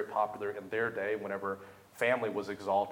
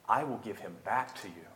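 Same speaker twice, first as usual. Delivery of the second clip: Hannah. Acted. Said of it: "she says".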